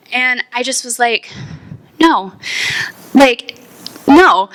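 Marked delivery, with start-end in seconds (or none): 2.0-4.5 loud, firm "no"; firm refusal. Retold or imitated. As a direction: shut the urge down hard. Refusing to throw up.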